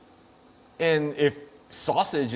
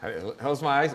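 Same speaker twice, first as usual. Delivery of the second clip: ordinary delivery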